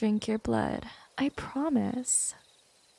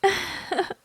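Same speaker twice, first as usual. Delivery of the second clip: short, high chuckle; vampire's little laugh; amused chuckle; 8 semitones higher than usual. Acted. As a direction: let out a brief amused laugh.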